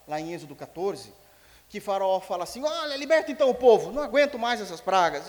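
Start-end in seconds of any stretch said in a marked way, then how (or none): 2.6-5.3 loud, fed-up outburst; exasperated Pharaoh. Retold or imitated. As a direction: throw up your hands and shout it out. Pharaoh giving up under plagues.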